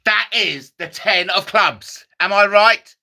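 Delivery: normal talking voice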